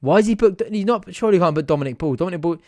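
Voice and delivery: loud, high incredulous question; indignant protest. Real, not acted. Fan insisting the wrong player was booked, loud. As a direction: argue with the referee.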